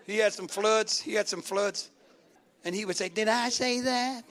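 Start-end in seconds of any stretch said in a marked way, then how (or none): 3.1-4.2 slow, put-on sitcom catchphrase voice; Urkel. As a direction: stretch it out as a comic character impression. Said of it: "a guy named Urkel".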